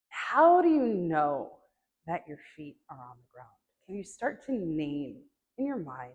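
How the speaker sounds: unhurried meditation guide, long silences between phrases; guided meditation. Acted.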